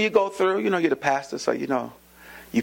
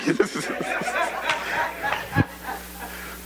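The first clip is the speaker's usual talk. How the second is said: laughing at his own prayer; high breathy laugh; 3 dB quieter than usual. Real, not acted. Preacher laughing with congregation at his tired prayer. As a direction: let a light laugh out.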